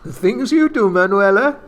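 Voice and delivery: put-on flirty Spanish accent. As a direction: tease her playfully. Antonio flirts, loud, high.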